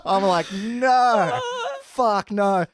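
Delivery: laughing at the memory of being told to headline. Real, not acted. Laughing while retelling.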